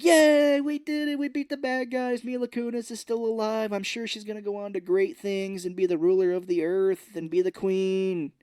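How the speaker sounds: very high, loud goofy celebration; mock crew cheering, high and giddy. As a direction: gush like a silly fan.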